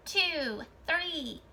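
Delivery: normal talking voice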